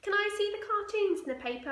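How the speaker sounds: put-on high, loud eager kid; a girl pestering mum; high-pitched loud child asking. Girl asks mother.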